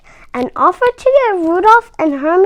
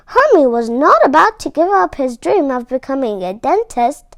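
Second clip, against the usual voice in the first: plain reading voice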